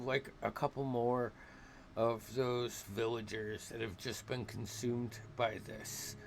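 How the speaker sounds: ordinary talk, nothing marked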